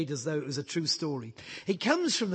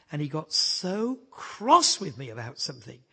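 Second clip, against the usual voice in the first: ordinary talk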